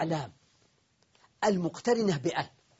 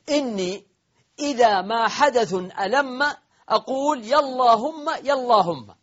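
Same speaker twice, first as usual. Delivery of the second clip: higher, louder, chanted verse; poem recitation; 6 semitones higher, 5 dB louder than usual. Shown as a demonstration. Teacher reciting the poet's verse, chanted and louder. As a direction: declaim the line like a poet. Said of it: "قول الشاعر".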